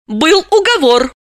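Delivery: high-pitched heroine reminding of a deal; high, pointed reminder; insistent reminder. A narrator's reading. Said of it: "И напомнила".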